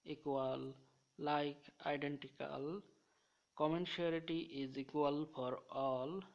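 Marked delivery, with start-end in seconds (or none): 3.5-6.2 very slow, word by word; slow dictation. Shown as a demonstration. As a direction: read the memory sentence slowly for students to copy. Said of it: "সবার জন্য আমি সমানভাবে comment করব".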